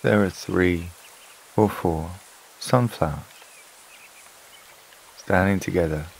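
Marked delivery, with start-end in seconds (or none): none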